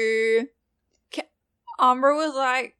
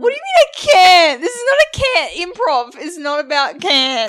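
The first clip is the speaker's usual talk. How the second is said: loud, high-pitched protest; indignant outburst; 6 semitones higher, 10 dB louder than usual. Real, not acted. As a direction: object loudly, playfully outraged. Mock quarrel over improv rules.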